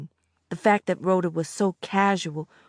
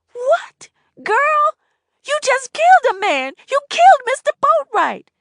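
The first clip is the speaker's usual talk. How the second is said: much higher, loud shrill outburst; shrieking disbelief; 10 dB louder than usual. A narrator's reading. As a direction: shout the truth at her. Annette's disbelief.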